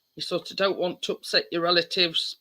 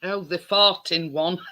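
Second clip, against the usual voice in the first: ordinary delivery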